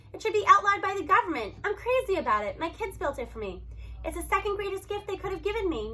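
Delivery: ordinary delivery, nothing marked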